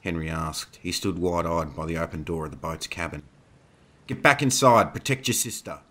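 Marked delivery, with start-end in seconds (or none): 4.1-5.8 urgent raised voice, pitched up; yelled order. A narrator's reading. Thomas orders the boy inside as wolves attack, yelled. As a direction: call out a protective order, higher than usual. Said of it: "Thomas yelled".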